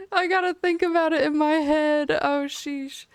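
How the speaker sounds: high, loud squeal through real laughter; laughing. Real, not acted. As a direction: squeak it out while cracking up. Cracking up after botching the line.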